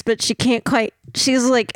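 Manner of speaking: ordinary talk, nothing marked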